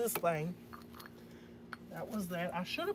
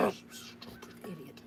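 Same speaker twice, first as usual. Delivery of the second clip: whispered, softer villain snarl; a quick slip into a mock evil whisper; 2 semitones lower, 8 dB quieter than usual. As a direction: spit the insult like a cartoon baddie under your breath. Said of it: "Why did my evil voice come out?".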